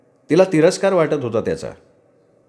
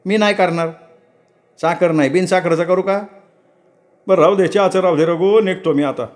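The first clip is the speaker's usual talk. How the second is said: higher, impatient village woman's voice; young wife Ratna; 6 semitones higher than usual. Acted. Wife Ratna hurrying her husband to market, impatient. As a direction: nag him to stop preening.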